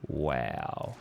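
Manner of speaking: very low, soft, drawn-out wow; retold reaction, dropped way down in pitch and volume; underwhelmed, quiet low murmur; unimpressed wow. Retold reaction to racist story.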